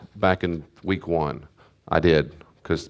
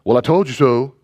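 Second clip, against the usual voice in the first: higher and louder, smug and mocking; smug told-you-so; 5 semitones higher, 5 dB louder than usual. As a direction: rub it in like a know-it-all parent.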